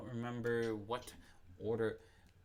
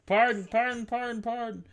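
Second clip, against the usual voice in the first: pardon; 12 semitones higher, 9 dB louder than usual. Real, not acted. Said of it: "excusing a burp".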